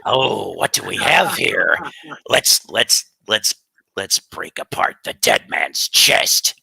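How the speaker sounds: hammed-up slow pirate breaking into high squawks; theatrical pirate, slow then screeching. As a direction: relish it like a storybook pirate. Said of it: "You sound like the pirate from SpongeBob".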